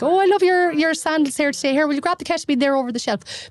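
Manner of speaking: high, gushing over-friendly chatter; mock small talk. Doctor mocks chit-chat before asking for ketamine, gushing. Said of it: "beating about the bush".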